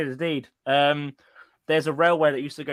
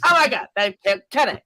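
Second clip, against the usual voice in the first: higher, louder mock-posh cartoon line; posh South Park voice; 5 semitones higher, 4 dB louder than usual. Shown as a demonstration. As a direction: deliver the catchphrase in a posh cartoon voice. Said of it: "in that voice".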